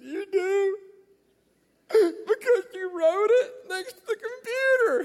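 very high, loud mock sobbing; hammed-up wail, drawn out through tears; wailing wife. Crying wife confronts husband with proof, hammed-up wail.

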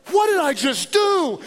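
high panicked cry. Retold or imitated. Pastor recalling his panic over the cost, a panicked cry. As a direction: blurt it out in a sudden panic.

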